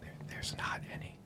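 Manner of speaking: very soft, voiceless whisper. Pretend. Host confides there's no hard proof, in a whisper. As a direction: whisper it like a secret. Stage-whispered answer to co-host's question.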